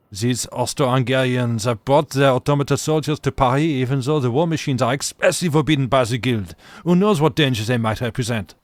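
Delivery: put-on loud, indignant French-accented outburst. Artificer cursing about automata soldiers in Paris, French accent. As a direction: curse in a thick peasant French accent. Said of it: "in his peasant French accent".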